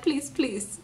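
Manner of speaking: soft, imitated plea; understated mock begging; retold pleading. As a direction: beg quietly, only half acting it. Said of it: "And he's like".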